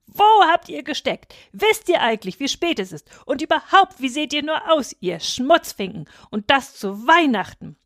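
high-pitched scolding outburst; angry bellowing. A narrator's reading. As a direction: tell them off as an outraged father. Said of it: "dann brüllte er los". (wütend losschimpfen)

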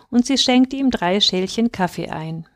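plain reading voice

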